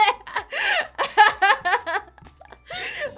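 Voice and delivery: high loud burst of laughter. Real, not acted. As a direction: laugh out loud at the awkward moment. Streamer laughs at awkward chat.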